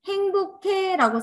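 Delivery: "행복해" lifted high and slow; bright "haengbokhae". Shown as a demonstration. Teacher showing readers the wholehearted way: "행복해" high and slow. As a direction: say "행복해" high, drawn out and sure. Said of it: "예를 들어".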